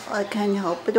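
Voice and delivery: ordinary delivery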